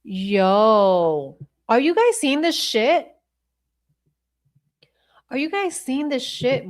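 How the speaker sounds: high-pitched, incredulous question; amazed disbelief. Real, not acted. Streamer asking viewers about the footage, amazed.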